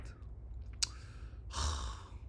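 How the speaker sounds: long, soft exhaled sigh; quiet sigh of frustration; exasperated sigh. Real, not acted. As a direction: let the breath out after a slip. Sighs after cursing own slip.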